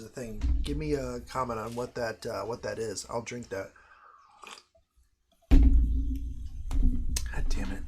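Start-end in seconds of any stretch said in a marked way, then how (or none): 5.5-7.8 whisper. Real, not acted. Muttered curse, unvoiced.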